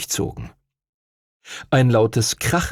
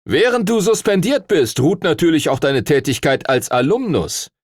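high, louder call across the hall; calling after; 7 semitones higher, 3 dB louder than usual. Acted. Stuart Todd calls after the departing Duncan, raised call. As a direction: call it after him as he leaves. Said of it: "ihm hinterherrief".

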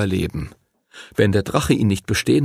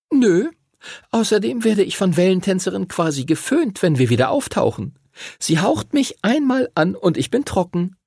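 put-on high-pitched girl character, explaining; 10 semitones higher than usual. Voices the girl's answer.

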